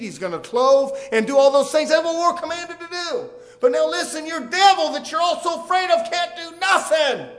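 rising to a high, loud declaration; full-force preaching. Real, not acted. Preacher telling congregation the devil is powerless, impassioned preaching. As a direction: proclaim it to the whole church, impassioned.

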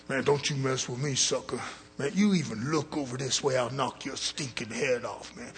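lightly voiced threatening convict; mild mock growl of a jailhouse bully; prison tough guy. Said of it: "Guy in prison".

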